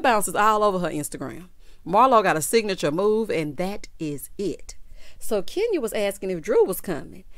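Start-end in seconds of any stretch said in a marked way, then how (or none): none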